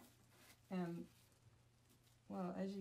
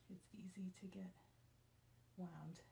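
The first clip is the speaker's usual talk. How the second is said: whisper; 10 dB quieter than usual. Real, not acted. Quiet aside.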